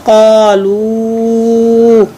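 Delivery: कालू with the long u held far out; long madd. Shown as a demonstration. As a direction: stretch the madd of कालू slowly. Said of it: "लाम पेश व मदलू".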